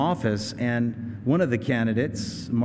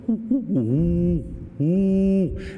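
high, louder hooting ooh ooh; ostrich call; 6 semitones higher, 4 dB louder, much slower than usual. Retold or imitated. Preacher mimicking ostriches sensing danger, loud high hoots. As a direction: boom it out like an ostrich in the night. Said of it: "they'll just go".

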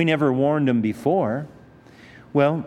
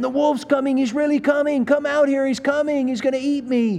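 much higher, hammed-up panicked kid calling; boy crying wolf; 9 semitones higher than usual. Preacher voicing the boy calling villagers out again. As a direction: pitch up like a frantic little boy.

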